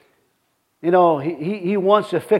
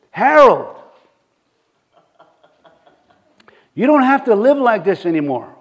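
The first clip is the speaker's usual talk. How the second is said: raised, emphatic call by name; voice from the Lord; 3 semitones higher, 3 dB louder than usual. Retold or imitated. The Lord calling to Harold in the pub, emphatic voice from above. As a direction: call out firmly to get his attention. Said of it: "he heard another voice again".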